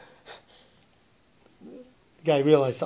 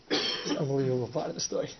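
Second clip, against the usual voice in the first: normal talking voice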